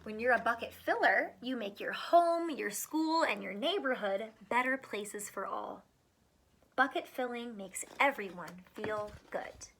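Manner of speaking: lively picture-book read-aloud; reading to children. Acted. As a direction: read it out to a class of small kids. Reads picture book to kids.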